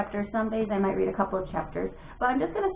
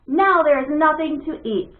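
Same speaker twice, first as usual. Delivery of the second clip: sulky complaint; 5 semitones higher, 7 dB louder, slower than usual. Acted. Bat complains after slamming.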